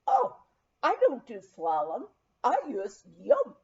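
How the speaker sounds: Viking skier scoffing at slalom; Norwegian accent. Acted. Viking skier answering the puzzled narrator, in a Norwegian accent.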